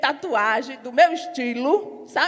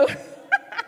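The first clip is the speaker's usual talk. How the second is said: airy laugh; 12 semitones higher than usual. Real, not acted. Laughs after preaching point.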